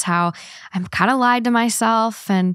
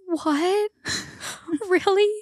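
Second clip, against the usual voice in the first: much higher and softer, close to tears; a small stunned voice on the edge of crying; teary disbelief; 9 semitones higher, 5 dB quieter, much slower than usual. Retold or imitated. Burnt-out friend hearing the proposal hint, tearful soft disbelief. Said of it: "her voice starts, like, cracking".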